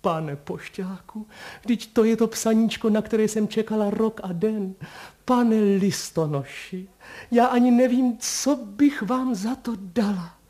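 high, loud overjoyed gush; a girl thanking the postman, overjoyed and high; joyful girl. Acted. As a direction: pour out your delight.

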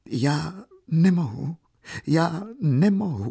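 high, slow, acted tearful refusal. A narrator's reading. Katerina refusing her aunt in tears, narrated plaintively. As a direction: draw it out high, as if weeping. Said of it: "opakovala Katerina plačíc".

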